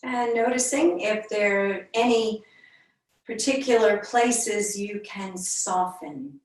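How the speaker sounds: unhurried meditation voice with long pauses between phrases; yoga teacher leading a calm body scan; guided meditation. Real, not acted. Leads a centering meditation.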